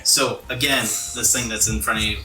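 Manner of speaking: usual speaking voice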